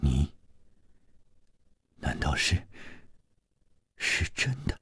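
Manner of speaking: hushed, halting disbelief; stunned. A narrator's reading. Lover learns of the betrayal, stunned and hushed. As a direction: falter very softly, in long shocked pauses. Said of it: "吃惊地望着他说".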